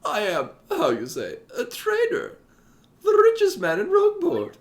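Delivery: high squeaky merchant voice; high falsetto character voice. Acted. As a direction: play a boastful rich trader. Voicing Don Flavio falsetto.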